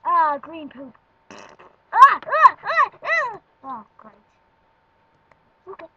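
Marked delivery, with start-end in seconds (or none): 1.9-3.4 loud, high shrieking ahs; high mock screams. Reacting to green poop drawing.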